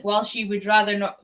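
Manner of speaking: usual speaking voice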